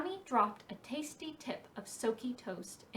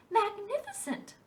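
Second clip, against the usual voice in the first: delighted; 11 semitones higher than usual. Acted. Brownie claps at gleaming ice.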